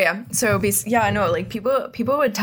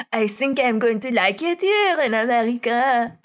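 higher, hammed-up French boy; 4 semitones higher than usual. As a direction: lay on a cartoonish French accent. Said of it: "like, so stereotypical".

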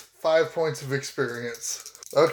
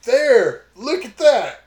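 excited; 5 semitones higher, 7 dB louder, slower than usual. Real, not acted. Player points out body cam.

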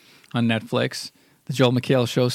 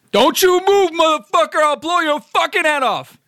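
yelling cop; 12 semitones higher, 4 dB louder than usual. Acted. Acting a Goodfellas cop scene.

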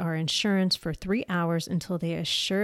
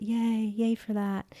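mock cheer; 4 semitones higher, slower than usual. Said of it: "I'm just gonna say yay for that".

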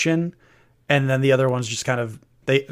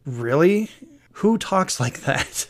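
incredulous; 5 semitones higher, slower than usual. Real, not acted. Incredulous mocking question.

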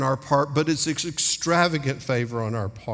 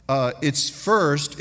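ordinary talk, nothing marked

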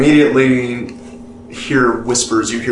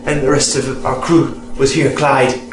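usual speaking voice

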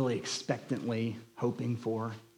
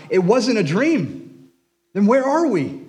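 cried aloud; 10 semitones higher, 10 dB louder than usual. Acted. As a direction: let memory burst out of him in a cry. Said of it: "Sam cried aloud".

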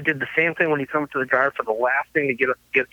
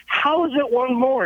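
higher, drawn-out baffled protest; mock indignant question, pitched up and slow; retold incredulity; 8 semitones higher, slower than usual. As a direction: imitate a friend disputing it in disbelief. Retold argument with customs, baffled drummer.

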